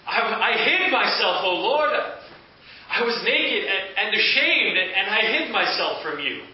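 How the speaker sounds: Adam confessing to God, high, loud and stammering; crying out in shame. Acted. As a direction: blurt it out ashamed and shaken. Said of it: "cried out".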